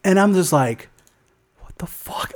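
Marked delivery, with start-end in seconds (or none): 1.6-2.3 whispered disbelief under the breath; inner whisper. Retold or imitated. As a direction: mouth it silently to yourself. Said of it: "in my head, I'm like".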